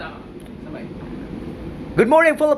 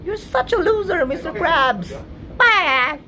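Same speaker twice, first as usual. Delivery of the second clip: very high, squeaky cartoon voice; high-pitched cartoon kid voice; SpongeBob-style squeak; 8 semitones higher than usual.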